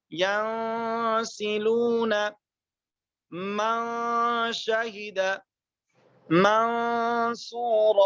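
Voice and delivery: ینسلون, من شہد, منصورا each drawn out with the نون hummed through the nose; slow recitation, nasal hum on every نون; measured tajweed recitation with ghunna; ikhfa with ghunna. Shown as a demonstration. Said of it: "میری آواز ناک میں جاتی ہے".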